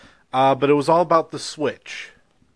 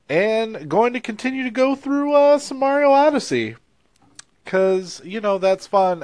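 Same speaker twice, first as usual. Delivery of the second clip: high playful voice; 9 semitones higher than usual. Acted.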